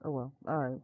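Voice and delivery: normal talking voice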